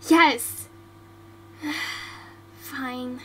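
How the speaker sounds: usual speaking voice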